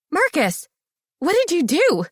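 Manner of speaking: high, loud startled call of his name; shocked cry. A narrator's reading. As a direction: gasp out his name in disbelief. Shocked woman sees proposal.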